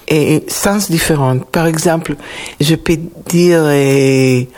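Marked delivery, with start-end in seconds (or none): none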